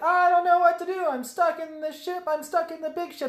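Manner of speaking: high-pitched mock helpless wail; exaggerated high, whiny distress; mock panicked kid. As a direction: play a kid panicking at the controls. Voicing panicked Anakin in cockpit.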